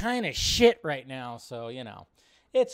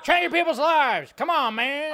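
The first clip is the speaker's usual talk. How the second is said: much higher, louder mock of the angry old president; mocking impression; 10 semitones higher, 8 dB louder than usual. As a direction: parody the grumpy politician, high and loud.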